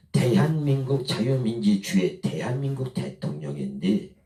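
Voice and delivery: usual speaking voice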